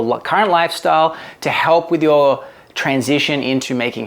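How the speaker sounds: lifted, presenter-style promo read; sponsor ad read. Acted. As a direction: read the sponsor copy steadily and emphatically. Reads a sponsor message.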